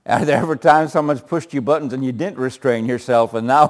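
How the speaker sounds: normal talking voice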